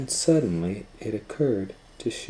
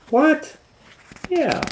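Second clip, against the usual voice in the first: very high quick exchange; surprised squeak. Acted. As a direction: squeak it in disbelief. Disbelief then confirmation.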